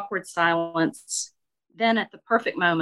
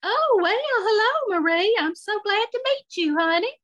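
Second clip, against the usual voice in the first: much higher, excited and uncomfortable; voice up an octave. Acted. Shirley greeting Marie, voice jumping up an octave. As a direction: shoot the voice way up, flustered but gracious. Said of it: "Her voice went up an octave".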